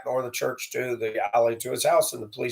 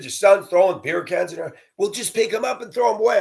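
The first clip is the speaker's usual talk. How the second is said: higher, faster put-on voice; mock neighbor; 8 semitones higher than usual. Neighbor woman brushing off the complaint, mocked. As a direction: brush it off in a mocking voice. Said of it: "I said".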